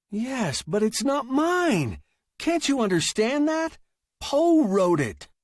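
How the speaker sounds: high, insistent protest; exasperated writer. Acted. Writer arguing with his machine over Poe, high and exasperated.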